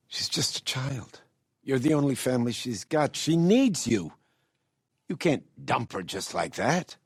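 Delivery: high-pitched, insistent appeal; pleading. Acted. Appealing to Philip for a child's sake, pleading.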